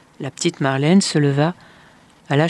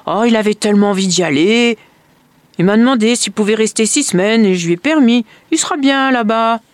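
high, loud overeager reassurance; smooth it over too eagerly; stepmother covering up; 6 semitones higher, 6 dB louder than usual. A narrator's reading.

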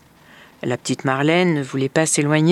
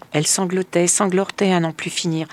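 usual speaking voice

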